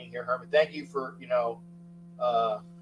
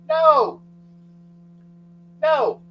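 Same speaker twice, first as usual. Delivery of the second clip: louder and higher, hammering the no; emphatic loud no; 8 semitones higher, 11 dB louder than usual. Real, not acted. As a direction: shut the idea down loudly. Emphatic answer to own question.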